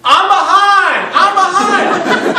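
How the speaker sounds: put-on very loud, high, stretched-out child's wail; yelling. Fourth grader breaking into the argument, yelling. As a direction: cry it out at full volume. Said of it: "starts yelling".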